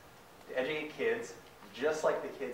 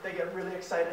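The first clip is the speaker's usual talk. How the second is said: ordinary delivery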